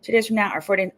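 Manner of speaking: normal talking voice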